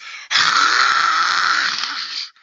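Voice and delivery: loud, long steam hiss; noisy mouth-made espresso hiss; espresso machine noise. Retold or imitated. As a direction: imitate a blasting espresso machine. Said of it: "of the espresso machine".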